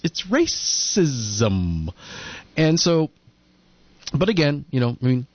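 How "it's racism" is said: drawn out. Acted. Drawn-out punchline.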